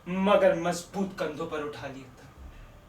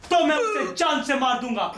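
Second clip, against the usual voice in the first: loud, higher, laughing and crying at once; tearful threat; 9 semitones higher, 8 dB louder than usual. Acted. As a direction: explode with a broken, tearful threat. Threat protecting his grandfather. (रोते-हँसते, चीखकर जान से मारने की धमकी)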